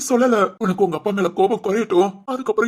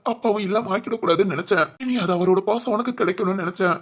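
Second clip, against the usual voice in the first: ordinary talk, nothing marked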